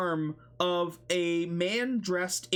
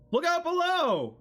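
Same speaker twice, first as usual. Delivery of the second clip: high, loud call from above; shouted warning; 13 semitones higher, 5 dB louder than usual. Acted. A figure dropping in calls out loud to the group. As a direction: yell the warning down at them.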